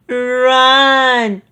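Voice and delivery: high, loud yell of fright; panicked cry. A narrator's reading. Shaggy yells to everyone as the ghost returns, high panicked cry. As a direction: shout it as you flee in terror. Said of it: "Shaggy cried".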